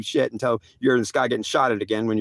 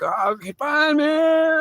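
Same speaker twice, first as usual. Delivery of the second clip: high, whiny acted surrender; exasperated giving in; 14 semitones higher, slower than usual. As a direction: give in with a drawn-out high-pitched protest. Acted reluctant giving in to God.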